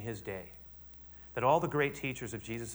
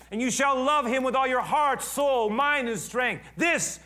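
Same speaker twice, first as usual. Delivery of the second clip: full-force preaching; 11 semitones higher, 8 dB louder, slower than usual. Acted. Pastor proclaims the Shema at full force.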